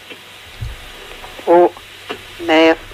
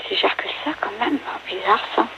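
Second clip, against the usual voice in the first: whispering; 11 dB quieter than usual. Real, not acted. Aside to daughter during call.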